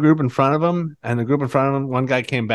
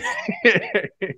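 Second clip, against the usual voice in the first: high laugh; 3 dB quieter than usual. Real, not acted. Laughing after the impression.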